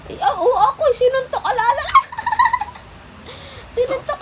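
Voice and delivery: put-on baby voice. Parent voices baby, high.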